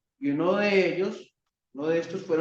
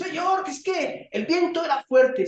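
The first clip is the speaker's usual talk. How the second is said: whining excuse; 9 semitones higher than usual. Pretend. Peter excusing himself to the Lord: high whining shout. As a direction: plead an excuse to the Lord, higher. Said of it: "Él pudo decir".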